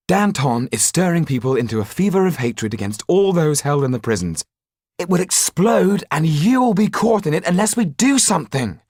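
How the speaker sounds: desperate plea to act now; urgent young Jan. Acted. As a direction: urge him, the danger is close. Jan urgently warns of danger.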